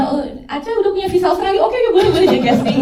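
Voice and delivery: high, fast, joking casting verdict; mock eager approval. Guest imitating why they cast her, mock eager. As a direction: rattle it off high, as a playful imitation. Voices casting team's imagined reasoning.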